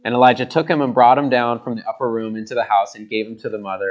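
usual speaking voice

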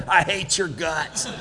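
a hint of a flat cold wife; wife answering husband's greeting with a cold jab; cold wife retort. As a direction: drop it on him like a cold wife. Wife's cold reply to husband.

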